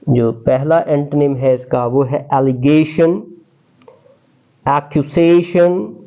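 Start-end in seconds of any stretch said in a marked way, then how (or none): none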